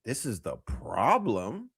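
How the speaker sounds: slow, low mocking echo of her words; slow, low sarcastic repeat; mocking quote. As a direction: parrot her slowly to mock her. Mocking the therapist's line.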